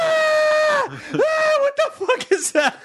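hammed-up crowd screaming in fear; panicked scream. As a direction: shriek in mock terror at the dog. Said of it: "And everyone's like".

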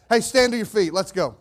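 loud, high call to the crowd; rallying call. Real, not acted. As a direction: get the whole room on its feet. Calling the congregation to stand.

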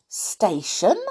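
puzzled, slowly drawn-out question; astonished boy in a children's story. A narrator's reading. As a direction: query it in mild disbelief. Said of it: "said Joe in astonishment".

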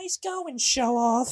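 high put-on girl's jeer; mock scolding girl. Emily blaming Rocky, mocking high-pitched scold. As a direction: imitate her sarcasm in a very high voice.

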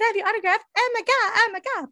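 high, loud gushing fan voice; fangirl squeal. Pretend. What she couldn't act like in front of the star, gushing fan. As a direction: gush like a starstruck fan. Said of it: "I can't stay outside and be like".

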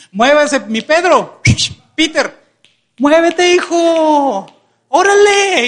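loud, high calling out; acted impatient urging, pitch climbing; hurrying someone along. Hungry crowd hollers at Peter serving food, loud and impatient.

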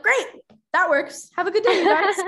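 high, loud, cheery send-off; chirpy producer signing off. Retold or imitated. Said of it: "And they're like".

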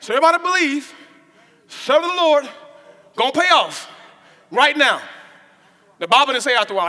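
loud rhythmic preaching call. Acted. Preacher calling the church to believe, preaching. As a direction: preach it out to the congregation.

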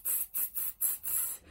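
very soft, voiceless repeated "tss"; hissed "tss". Shown as a demonstration. Teacher shows the odd ending, whispery "tss". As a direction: isolate the "ts" ending as short hisses. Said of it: "puede sonar un poquito raro por el tss".

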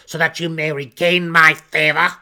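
loud, high, raging demand; roaring. A narrator's reading. Matron mother roaring a taunt at her son. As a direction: keep roaring, mocking his failure. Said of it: "Malice roared".

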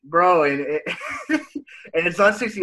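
laughing through the words; giggling while talking. Real, not acted. Laughing at age talk.

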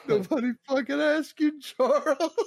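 a high, laughing snap at Charles; laughing mock put-down. Telling Charles off while laughing in a high voice. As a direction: laugh through the insult.

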